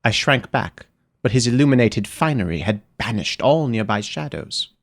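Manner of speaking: usual speaking voice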